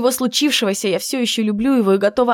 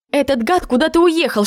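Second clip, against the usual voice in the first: indignant cursing; 7 semitones higher than usual. Acted. Friend reporting that the scoundrel has left, indignant. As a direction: pitch jumps up in annoyance.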